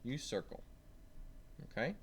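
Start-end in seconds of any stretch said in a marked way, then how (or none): none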